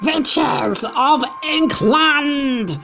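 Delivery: very high, loud, slow witch cry; witch shout. Acted. Grand High Witch hailing her assembled witches, screeching slowly. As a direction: stretch it out like a screeching witch queen. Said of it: "shouted the Grand High Witch".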